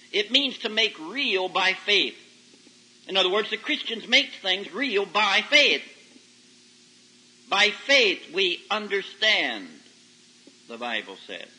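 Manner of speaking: normal talking voice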